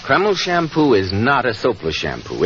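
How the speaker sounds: usual speaking voice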